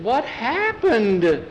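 high, drawn-out shocked question; bewildered. A narrator's reading. Missus Miller sees the exploded cake, bewildered. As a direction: stretch it out slowly, pitched high in astonishment. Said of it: "Missus Miller looked bewildered".

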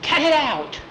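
high, sharp mock complaint; irritated protest. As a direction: object like a fed-up kid.

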